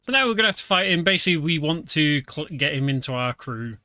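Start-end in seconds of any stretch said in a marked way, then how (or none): none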